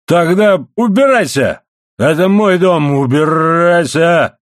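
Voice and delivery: high, loud roar, drawn out; enraged bellow. A narrator's reading. Husband orders his wife out of his house, bellowing. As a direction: bellow the order, stretching the last word. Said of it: "завопил Моррелл".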